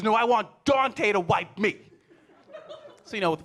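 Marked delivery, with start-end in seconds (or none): 0.0-1.8 louder, slow, stubborn; insistent kid. Retold or imitated. Son insisting to his dad, louder and slow. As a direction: dig in like a stubborn four-year-old. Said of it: "He goes".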